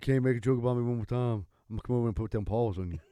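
low threat, speeding up at the end; Shaq not playing. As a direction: mimic a deadly serious warning. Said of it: "Shaq wasn't playing".

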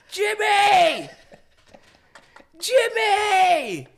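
very high, drawn-out re-enacted scream; angry yelling. Patient yelling for his uncle who walked off, furious acted scream. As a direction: bellow the name, stretched out in exasperation.

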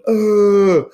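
high, loud mocking "oh"; jeering crowd noise. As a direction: hold the "oh" like a heckling student crowd. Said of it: "which is make the".

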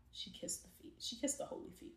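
unvoiced hushed whisper; awed whisper about the holy feet; reverent whisper. Real, not acted. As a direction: whisper it with awe.

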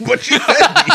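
high squeal through laughter; laughing. Real, not acted. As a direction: giggle the words out. Gushing over couple feeding scene.

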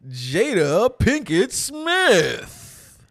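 big slow announcer reveal, drawn out; game show host. Pretend. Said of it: "Why did I say that like a game show host?".

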